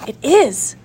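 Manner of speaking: a touch of excitement; eager little reveal; mildly excited answer. A narrator's reading. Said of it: "said Alice excitedly".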